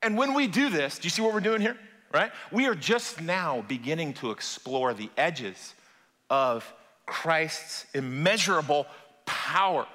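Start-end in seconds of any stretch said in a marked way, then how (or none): 2.5-9.9 slow, weighty preaching. Real, not acted. Preacher slows for climactic point.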